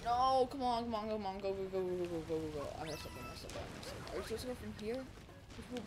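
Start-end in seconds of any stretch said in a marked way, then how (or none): 0.0-2.7 urgent pleading. Real, not acted. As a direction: urge it on in a tumbling, repeated rush. Urging the game on.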